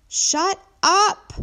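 high, loud shout; exasperated neighbour hollering; angry yell. A narrator's reading. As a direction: yell both words hard. Neighbour yells at braying donkey.

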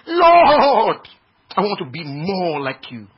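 mock pious wail. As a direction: wail it like an overwrought worshipper. Mimics a pious worshipper crying out.

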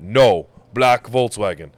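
mock a driver's emphatic broken English, drawn out. Driver refusing a caller's question, slow broken English. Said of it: "super broken English".

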